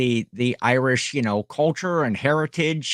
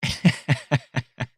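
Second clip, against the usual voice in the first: chuckle at own joke. Real, not acted. Laughs at own bit.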